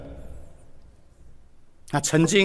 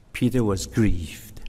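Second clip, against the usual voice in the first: slow, lower and softer, heavy with grief; grieved; 7 semitones lower, 4 dB quieter, slower than usual. Shown as a demonstration. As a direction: let "grieved" sink low and slow. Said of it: "很多是翻译成sorrowful、grief、hurt".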